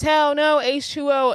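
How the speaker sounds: imitated group holler; retold crowd scream. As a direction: shout it like a bar crowd, half in earnest. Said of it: "Everyone at the bar screams".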